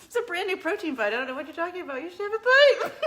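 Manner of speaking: high laughing innocent act. Real, not acted. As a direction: laugh while playing innocent. Joking innocent offer of food.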